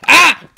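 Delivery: short, high, loud yelp; sudden shriek of alarm; startled scream. Real, not acted. Startled by attack in game.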